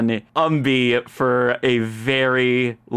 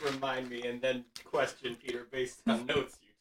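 quiet aside; 4 semitones lower, 15 dB quieter than usual. Real, not acted. Soft aside continued.

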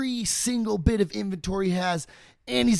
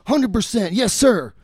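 higher and louder, overjoyed agreement; thrilled yes; 5 semitones higher, 6 dB louder than usual. Retold or imitated. Guy accepting Edison's partnership offer, thrilled. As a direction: jump at the offer.

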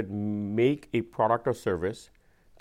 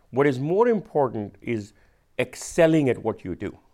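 ordinary talk

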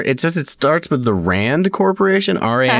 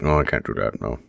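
quiet; 6 dB quieter than usual. Retold or imitated. Mock quote low voice.

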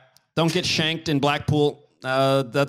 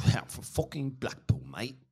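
softer put-on Blackpool accent; mock Blackpool accent; 8 dB quieter than usual. Local cursing about Blackpool, mock Blackpool accent.

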